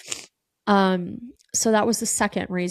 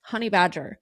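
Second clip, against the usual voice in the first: ordinary delivery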